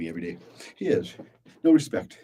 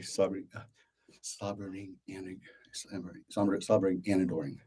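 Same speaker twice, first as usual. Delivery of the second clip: quiet practice; 8 dB quieter than usual. Shown as a demonstration.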